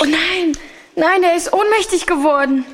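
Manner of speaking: sudden alarmed cry. Acted. Friend faints suddenly.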